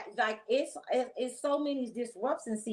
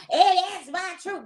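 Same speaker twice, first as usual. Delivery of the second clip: imitating someone proudly announcing "my truth", higher and louder; loud, higher, mocking confession voice; mock truth-teller; 8 semitones higher, 7 dB louder than usual. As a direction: ham up a self-righteous declaration. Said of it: "You gonna come".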